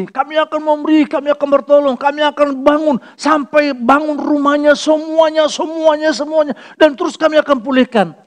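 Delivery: mocking the organisation's loud pledges to help Cianjur; mock boastful promise. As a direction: parody the big talk of an ormas.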